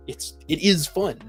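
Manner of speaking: ordinary talk, nothing marked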